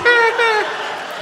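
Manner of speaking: high cackling witch laugh; witch cackle. Retold or imitated. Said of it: "the Wicked Witch of the West showed up".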